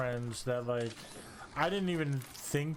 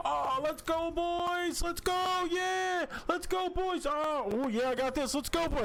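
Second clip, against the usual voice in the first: hyped streamer; slower than usual. Retold or imitated. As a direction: scream it like a hyped gamer. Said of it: "so he's like".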